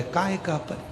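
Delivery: hushed indignation. Acted. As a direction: disown them under the breath. Disillusioned man rejects his family.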